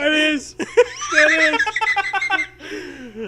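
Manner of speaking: high, laughing repeat, breaking up. Real, not acted. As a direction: giggle the words out. Cracking up with partner.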